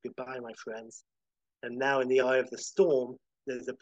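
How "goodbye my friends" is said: hushed, much softer farewell; soft, to oneself. Acted. Howard to himself as he disintegrates, softly. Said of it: "Howard softly to himself".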